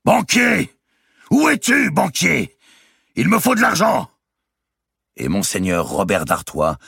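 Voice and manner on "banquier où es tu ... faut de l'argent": huge bellow, far higher and louder; thundering shout. A narrator's reading. Robert d'Artois bursts in, bellowing for the banker. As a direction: roar it through the whole house. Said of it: "Une voix tonna".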